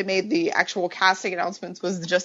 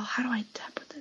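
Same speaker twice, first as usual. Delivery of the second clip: quick muttered whisper to herself; whispered aside; 4 semitones higher, 11 dB quieter, faster than usual. Real, not acted. Co-host struggling with the app, hushed whisper. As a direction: breathe it out under your breath, fast. Fiddling with the app mid-show.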